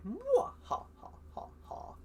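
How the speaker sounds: high hammed-up villain cackle, fading to breath; evil cackle. The host opens the episode with a hammy evil cackle. Mock villain laugh at game start.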